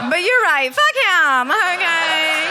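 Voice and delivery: high, worked-up comic outburst; hammed-up defiant rant; mock outraged agreement. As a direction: act out indignantly taking the stranger's side. Her heated reply in retold scene.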